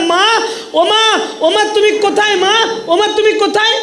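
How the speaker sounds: put-on child shouting for his lost mother; desperate calling, pitched high. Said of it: "মা, মা বলে চিৎকার করতে লাগলাম".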